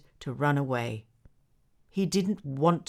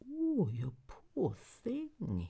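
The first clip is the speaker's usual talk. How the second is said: put-on soft, slow kind old man; lightly voiced tender old man; gentle old man; 4 semitones higher, 5 dB quieter, much slower than usual. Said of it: "he said".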